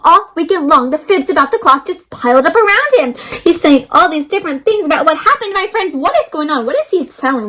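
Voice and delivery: ordinary delivery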